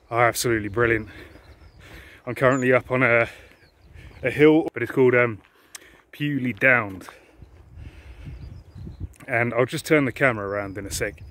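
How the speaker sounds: normal talking voice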